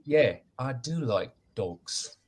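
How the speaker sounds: put-on slow, drawn-out British accent. Answering their own Snatch movie quote, slow British accent. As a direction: answer in your best British accent, taking it slowly. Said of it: "It's my best British accent".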